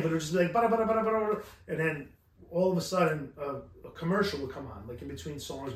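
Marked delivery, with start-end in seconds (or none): 0.5-1.4 fast nonsense syllables mimicking Spanish radio; mock gibberish Spanish. He mimics Spanish radio he could not understand, gibberish. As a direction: rattle off fake Spanish, raised pitch. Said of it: "I don't understand what they're saying".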